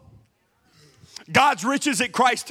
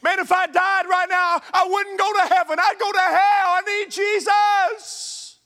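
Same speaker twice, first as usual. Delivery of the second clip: Big Dave pleading; 10 semitones higher, slower than usual. Retold or imitated. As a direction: play the desperate big man begging the pastor. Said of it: "Big Dave came to me and said".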